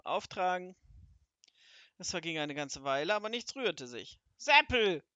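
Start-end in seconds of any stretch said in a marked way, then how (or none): 0.0-0.8 sharp loud call up to the servant, pitch jumping on Seppel; hungry wizard bellows for his servant. Acted. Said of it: "klatschte dann in die Hände und rief". 4.4-5.0 much louder, higher yell of the name; impatient wizard yells for Seppel; loud call. Acted. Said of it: "rief Zwackelmann".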